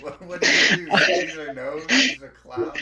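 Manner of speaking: loud, high laughing yell; laughing shout. Real, not acted. Friend shouting jokes at the honk story while laughing.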